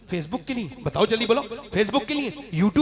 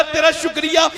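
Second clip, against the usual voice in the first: louder thanks, almost a shout; loud fervent prayer; 5 semitones higher, 8 dB louder than usual. Pretend. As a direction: call out the thanks with fervour.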